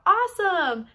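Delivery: loud, high "Awesome!" with a falling end; shouted call. Shown as a demonstration. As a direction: belt out "Awesome!" to the class. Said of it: "you're gonna shout out".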